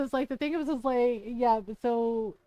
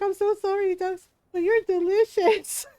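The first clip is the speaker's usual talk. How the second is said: put-on little girl apologizing; 7 semitones higher, 6 dB louder than usual. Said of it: "she's like".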